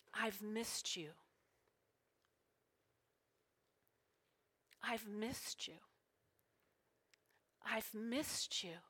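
soft, near-whispered words, slowly spaced; hushed and slow, leaving long silences; tender, hushed. Retold or imitated. Speaker relays God's words to her listeners, tender and hushed.

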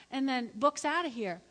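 ordinary talk

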